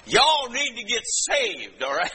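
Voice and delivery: slow, high Southern drawl of a preacher; Southern accent. Acted. A preacher voicing Southern listeners, Southern accent. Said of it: "they're speaking Southern English".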